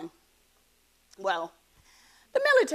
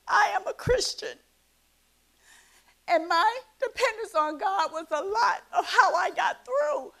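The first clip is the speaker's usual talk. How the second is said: much higher voice, close to crying; real tearful testimony; 8 semitones higher than usual. Real, not acted. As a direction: hold back real tears while testifying. Tearful testimony about faith and coping.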